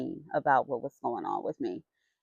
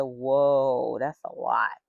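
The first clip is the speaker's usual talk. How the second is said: mock understatement; 5 semitones lower, much slower than usual. Reacting to doctor's news.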